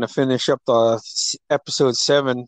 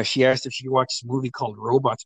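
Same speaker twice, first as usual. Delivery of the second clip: normal talking voice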